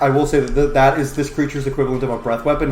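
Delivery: ordinary talk, nothing marked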